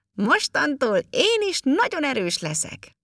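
high, triumphant little elf shout. A narrator's reading. Elf has kicked the ball back to the boy, shouting high. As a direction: call it out high and proudly. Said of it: "kiabálta".

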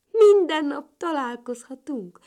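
high, loud happy exclamation. A narrator's reading. Little ladybird to her friend and neighbour, happy. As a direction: burst out with pleasure. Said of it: "mondta boldogan kis Katica".

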